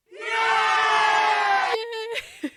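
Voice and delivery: high, loud happy cheer. Real, not acted. Cheering at chat.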